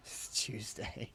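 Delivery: slow, whispered British meme 'Tuesday' said as Chewsday. Shown as a demonstration. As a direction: breathe out 'Tuesday' slowly as Chewsday, lightly mocking. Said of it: "when they say things like that".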